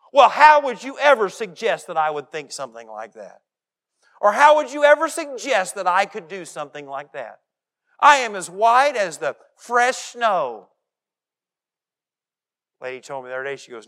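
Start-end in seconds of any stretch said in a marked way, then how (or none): 0.1-10.7 loud mock-offended protest, slowing at the end; false indignation. Preacher voicing Abner's fake outrage, loud and indignant. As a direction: play the wronged innocent. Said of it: "False indignation".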